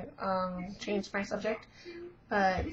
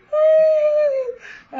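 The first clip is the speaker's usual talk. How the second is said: high, loud imitated crying squeal; mock wail; 9 dB louder than usual. Fan retells bawling at the film, mock wail. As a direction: wail it out in one long, hammed-up squeal. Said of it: "I was crying".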